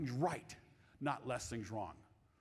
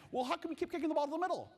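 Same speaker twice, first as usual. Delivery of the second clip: exasperated dad; 11 semitones higher, faster than usual. Retold or imitated. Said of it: "she's like".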